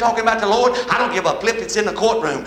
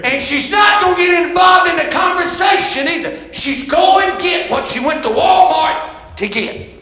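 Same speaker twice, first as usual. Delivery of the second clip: high, loud, drawn-out pulpit complaint; full-force preaching rant; 7 semitones higher, 4 dB louder, slower than usual. Real, not acted. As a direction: holler the complaint slowly to the crowd. Preacher rants about his wife.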